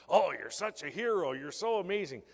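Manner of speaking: loud, high mock gushing; gushing TV hosts. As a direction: overblown praise, piled on at full volume. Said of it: "they were really beating on this kid".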